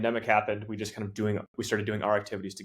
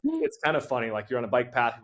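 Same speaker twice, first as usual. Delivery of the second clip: usual speaking voice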